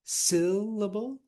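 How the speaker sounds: SYL-lable, first syllable loud, high and drawn out; syllable overstressed on its first beat, as a question; exaggerated first-syllable stress. Shown as a demonstration. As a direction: lean hard on the SYL of syllable. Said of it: "try the different options and just exaggerate them".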